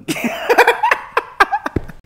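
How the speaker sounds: a high, breathy burst of real laughter; loud laugh. Real, not acted.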